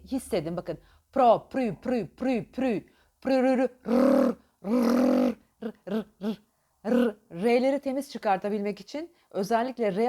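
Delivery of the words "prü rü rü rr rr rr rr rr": rr, rr, rr trilled on the tongue tip; rolled r. Shown as a demonstration. Coach demonstrating the trilled r exercise. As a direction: roll the rr with a busy tongue. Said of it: "R harfini söylerken içerideki hareketini".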